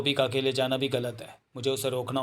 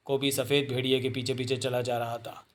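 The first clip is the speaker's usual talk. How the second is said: ordinary delivery, nothing marked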